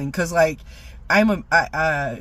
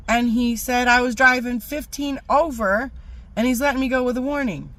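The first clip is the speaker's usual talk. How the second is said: normal talking voice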